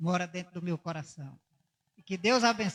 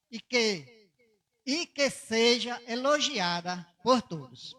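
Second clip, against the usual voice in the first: slow, solemn Bible reading; scripture reading; 3 semitones higher, 4 dB louder, slower than usual. Acted. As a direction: read the verse slowly for the church. Reads Proverbs verse aloud.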